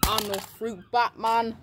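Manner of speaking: normal talking voice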